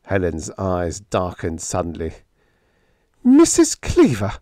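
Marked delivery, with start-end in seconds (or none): 3.2-4.3 very high, loud, outraged echo; indignant. Acted. Helen repeating the name given by Biggs, indignant. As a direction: throw the name back in shrieking outrage.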